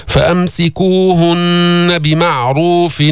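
slow chant, بمعروف with ع briefly sustained, no vowel after it; measured ayn. Shown as a demonstration. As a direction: recite slowly, letting ع in بمعروف close its syllable. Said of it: "مش بمعروف". (ترتيل بمعروف بعين ساكنة متوسطة)

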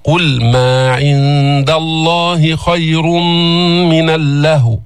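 قل ما عند الله with a thin, unthickened ل; light lam. Shown as a demonstration. Teacher models a light lam, chanting slowly. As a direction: keep the ل light in قل. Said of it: "رقق اللام".

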